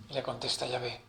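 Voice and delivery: normal talking voice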